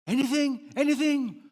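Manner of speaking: high, loud preaching cry; rising shout of the question; climactic cry. Real, not acted. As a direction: throw the question out to the hall.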